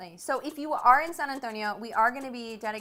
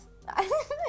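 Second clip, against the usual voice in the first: high-pitched laugh. Real, not acted. High laugh at own joke.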